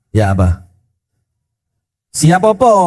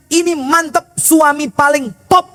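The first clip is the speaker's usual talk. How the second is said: delighted wife; 9 semitones higher than usual. Acted. Wife praising husband, high voice.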